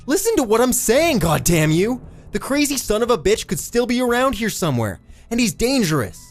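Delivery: high, loud, frantic demand; angry yelling. A narrator's reading. Eddie warns the cop the killer is near, yelling angrily. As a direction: yell to be believed. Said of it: "all the effort of standing and yelling".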